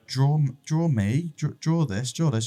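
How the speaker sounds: put-on high, loud, eager toddler voice; a two-year-old demanding a drawing; imitating his daughter. Daughter asking daddy to draw, high loud toddler voice. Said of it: "she was like".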